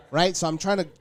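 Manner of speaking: ordinary talk, nothing marked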